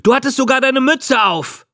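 put-on very high, insistent boy's cry; triumphant accusation. A narrator's reading. Boy to the old man he accuses, insistently crying out. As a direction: throw in the clinching detail, pitch way up.

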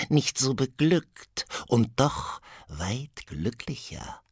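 high, slow, sly whisper; witch whisper. Acted. Second witch whispering a riddle to Benko. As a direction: hiss out the riddle.

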